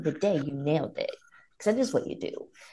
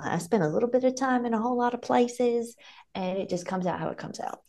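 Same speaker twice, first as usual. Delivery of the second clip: mixed Southern accent, pitched up at first; pan-Southern accent; 6 semitones higher than usual. Shown as a demonstration. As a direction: slip into a patchwork Southern accent. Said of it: "That was my pan Southern".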